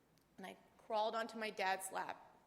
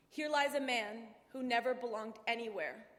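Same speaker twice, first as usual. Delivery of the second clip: pushed louder through emotion; emotional surge; 2 semitones higher, 6 dB louder than usual. Real, not acted. Daughter reading her father's epitaph aloud, pushing through emotion. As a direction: force the words out against the tears.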